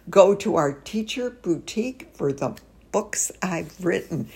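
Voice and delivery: ordinary talk, nothing marked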